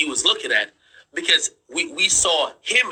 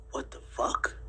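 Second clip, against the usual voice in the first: hushed, barely voiced disbelief; stunned whisper; 12 dB quieter, slower than usual. Telling viewers his first reaction to the dream, stunned whisper. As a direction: whisper it as a retold shocked reaction.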